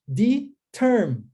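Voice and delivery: "Determined" said as de... termine, without final d; very slow "Determined", split after de, d dropped; present form. Shown as a demonstration. Teacher shows present tense, "Determined" slowly split with no d. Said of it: "no presente".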